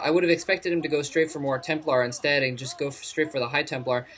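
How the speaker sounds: ordinary delivery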